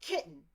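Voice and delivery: kitten with a blank sound and no T; kitten, the TT dropped; kitten with a stop. Shown as a demonstration. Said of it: "not even a D sound or a T sound in there".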